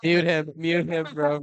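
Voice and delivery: loud laughing shout. Real, not acted. Shouting to mute someone.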